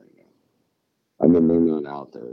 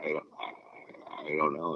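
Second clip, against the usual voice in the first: imitated stumped person; 2 semitones lower, 9 dB quieter than usual. Imitating people asked about the Panama Canal, lost and hesitant. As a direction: trail off softly, mimicking someone clueless. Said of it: "And they're like".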